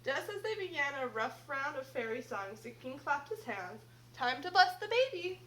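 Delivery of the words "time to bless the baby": loud, quick royal call; booming king's summons. Acted. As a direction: clap and call the court to order. King calls out at christening.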